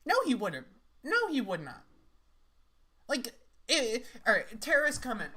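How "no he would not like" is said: normal talking voice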